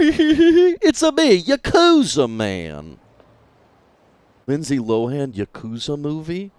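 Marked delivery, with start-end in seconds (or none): 0.0-3.0 high, very slow Mario-style it's-a-me; slow mock plumber voice. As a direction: stretch it out like Mario. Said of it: "now we all talk like this".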